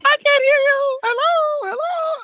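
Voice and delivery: very high mocking squeal; Stratton's taunt. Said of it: "starts doing that thing where she's like".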